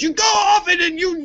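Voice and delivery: high, ranting impression of an angry father; mad dad. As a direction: rant it like a dad who's lost it. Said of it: "he was just mad".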